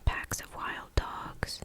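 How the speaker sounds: plain reading voice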